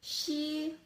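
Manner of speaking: "xi" said as Mandarin shee, high and clear; teacher modelling "xi"; Pinyin xi. Shown as a demonstration. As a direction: sound out "xi" the Chinese way. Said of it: "the words like".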